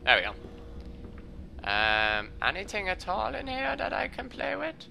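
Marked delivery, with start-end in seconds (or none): 2.4-4.8 squeaky put-on voice, high and soft; a playful squeaky little voice asking the room; silly high voice. A gamer putting on a silly voice to the empty room.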